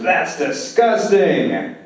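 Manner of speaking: hammed-up yuck reaction after a swig; disgusted. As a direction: stretch it out slowly in revulsion. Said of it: "He's disgusted".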